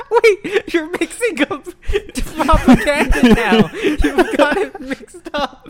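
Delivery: laughing through a protest; laughing while talking. Real, not acted. Friend's joke sparks laughter.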